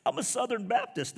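very high, loud strained stoner voice; pot-smoker imitation. Buddy answering while smoking a joint, comic stoner voice. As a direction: squeeze out the answer on a held toke. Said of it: "And he's like".

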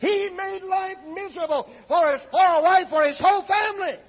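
high, loud impassioned pulpit cry; full-force preaching. Acted. Preacher describing husband's misery to church, impassioned shout. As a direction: declare it at the top of your range.

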